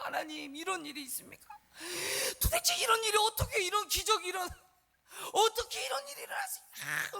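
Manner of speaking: acted high-pitched, strained lament; wailing protest in prayer; resentful complaint to God. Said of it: "하나님 앞에 막 원망 불평하면서".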